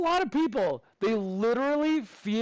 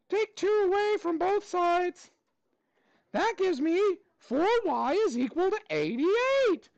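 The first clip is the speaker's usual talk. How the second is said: high, squeaky cartoon mouse voice held through the whole lesson; Mickey Mouse voice; 8 semitones higher than usual. Teacher quizzing his class on equations in a high Mickey Mouse voice. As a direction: teach the math steps as a cheerful cartoon mouse. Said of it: "I gotta do the voice too".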